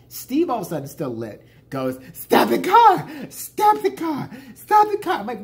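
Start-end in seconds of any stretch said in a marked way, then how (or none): 2.1-5.2 high, very loud imitated yell; frantic shouting. Drunk friend demands the driver stop, frantic yelling. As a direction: scream it like a drunk passenger in a panic.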